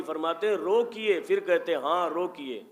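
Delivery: ordinary talk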